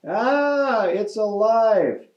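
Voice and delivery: high, drawn-out scream of horror; hammed-up storybook fright; mock scream. A narrator's reading. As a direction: scream it high, stretching the words, volume held back. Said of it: "The lady screamed".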